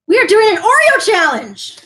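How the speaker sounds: big excited reveal; excited announcement. Real, not acted. Announcing the challenge to viewers.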